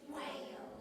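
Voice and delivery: a soft whispered prompt to the class; whispered hint. Acted. Teacher slips the class the answer in a whisper. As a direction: whisper the answer as a sneaky clue. Teacher hints the next pattern word.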